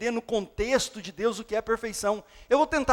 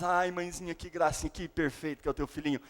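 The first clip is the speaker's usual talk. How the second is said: lower, softer doting coo; cooing doctor; 5 semitones lower, 8 dB quieter than usual. Acted. Doctor coos softly to the mother about her baby.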